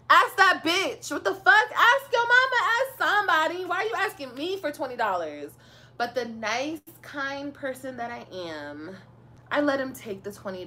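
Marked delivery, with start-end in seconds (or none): 0.1-5.5 loud, high, indignant outburst; exasperated rant. Real, not acted. Ranting at the ex who wanted money. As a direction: fling it at him, fed up.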